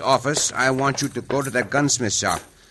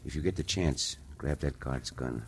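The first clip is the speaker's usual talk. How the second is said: much softer, lower hushed plan; whispered plotting; 10 semitones lower, 14 dB quieter than usual. Acted. Cisco and Pancho plotting escape past the guard, hushed. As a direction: keep it low so the guard cannot hear.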